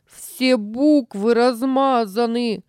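high, slow tearful wail; crying. A narrator's reading. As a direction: cry it out like a hurt boy. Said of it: "заплакал он".

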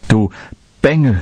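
angry curse. A narrator's reading. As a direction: snap it at the boy like a furious miller's wife. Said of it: "fluchte die erboste Frau". (wütend geschimpft)

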